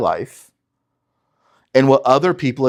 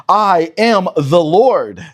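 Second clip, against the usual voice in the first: slow, weighty, a touch louder; solemn declaration; 5 semitones higher, 4 dB louder, much slower than usual. Acted. Reading God's proclamation to Israel, slow and solemn. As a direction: let God's words land slowly.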